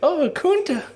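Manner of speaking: very high, hammed-up squeal; mock swooning woman. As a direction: play a flustered damsel.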